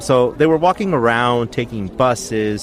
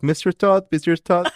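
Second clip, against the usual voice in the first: put-on high-pitched botched accent from Sweeney Todd; badly faked musical-character accent; attempted Sweeney Todd accent; 7 semitones higher than usual. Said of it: "I tried to do that accent of".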